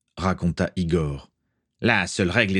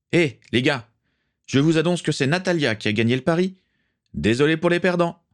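higher-pitched, lively announcement; calling out; 8 semitones higher than usual. A narrator's reading. Victor telling the men on deck who won, calling out. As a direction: announce it to the whole crew.

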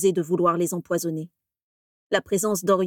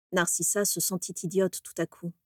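ordinary delivery, nothing marked

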